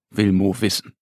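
ordinary delivery, nothing marked

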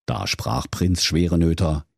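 plain reading voice

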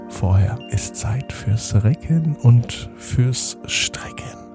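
bedtime stretching guide. Acted. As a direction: coax the kids to stretch, then settle. Stretching exercise before bedtime.

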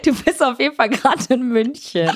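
amused laughing recap. Real, not acted. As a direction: giggle out the line. Laughing repeat of her status.

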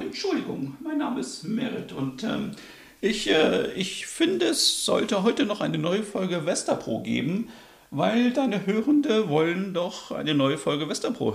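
squeaky high voice, soft; a small high voice; high-pitched character voice. Acted. Merit barges into the podcast.

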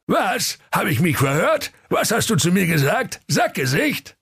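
very high, outraged squeak; raging Santa. Acted. Santa outraged at the insult, high squeaky fury. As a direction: demand in disbelief, high. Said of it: "Der Weihnachtsmann tobte".

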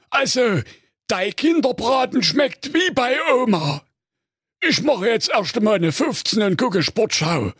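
greedy cartoon giant; ogre voice. (komische Menschenfresser-Stimme)

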